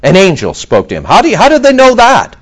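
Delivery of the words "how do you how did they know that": higher, louder disbelieving ask; can't believe they'd know; incredulous question. Real, not acted. Preacher doubting the crowd's angel claim, higher and incredulous.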